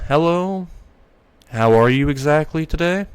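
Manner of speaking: mildly nervous, careful politeness. A narrator's reading. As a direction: ask shyly with rising pitch. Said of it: "She says in a nervous voice".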